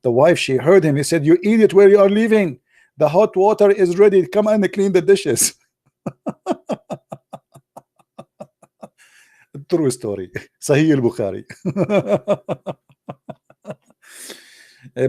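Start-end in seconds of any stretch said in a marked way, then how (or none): 1.2-5.6 high, loud scolding wife voice; hammed-up bossy wife telling him off. 6.1-7.3 high giggle at his own joke; real amused laugh. Real, not acted. Laughs at punchline. 11.5-12.7 laugh. Real, not acted. Laughs again.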